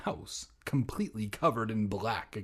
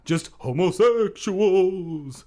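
slow, louder horror voice; spooky voice; 6 semitones higher, 8 dB louder, slower than usual. Acted. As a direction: drag it out like a horror movie. Spooky joke punchline.

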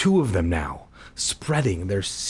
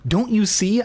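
high-pitched, exasperated cry; 9 semitones higher than usual. A narrator's reading. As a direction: plead to be believed. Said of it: "I cried".

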